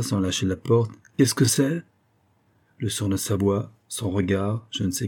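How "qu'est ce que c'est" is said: higher, rising insistent question; rude repeat. A narrator's reading. Old woman repeats it while holding the door, insistently rude. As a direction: repeat the rude challenge, pitched up. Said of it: "Elle répéta".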